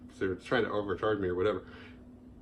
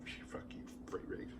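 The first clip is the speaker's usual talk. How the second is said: whispered curse; 18 dB quieter than usual. Retold or imitated. Private curse at the brand.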